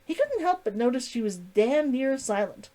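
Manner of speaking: usual speaking voice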